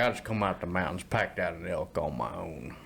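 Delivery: put-on lightly done old cowboy voice; Sam Elliott impression. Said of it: "talk kinda like this".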